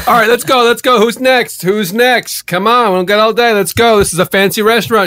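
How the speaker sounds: high, urgent calling out; impatient host. Acted. Restaurant host rushing waiting customers, calling out impatiently.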